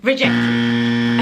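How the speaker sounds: deep robotic rejection; cold rejection voice. Retold or imitated. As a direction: stamp it down like a machine denying the request. Amazon's reply to her appeal.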